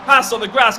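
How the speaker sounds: loud and urgent; excited shout. Real, not acted. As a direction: call it out fast and loud. Car forced onto grass live.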